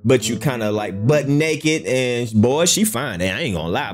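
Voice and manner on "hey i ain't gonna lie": ordinary talk, nothing marked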